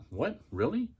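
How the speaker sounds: a stunned double take, rising high; surprised. Retold or imitated. Recalling Tracy's invitation to go out, a high surprised double take.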